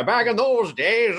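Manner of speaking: put-on high, slow old-timer voice; old man voice. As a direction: drawl it like a twenties old man. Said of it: "it was the old man voice".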